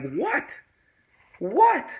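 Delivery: rising, shooting up high; acted bewildered "what". Acts stoned bewilderment.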